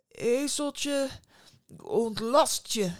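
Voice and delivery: slow, ceremonious magic command; drawn-out, stately call; solemn incantation. A narrator's reading. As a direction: intone it grandly, word by word. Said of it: "riep toen plechtig".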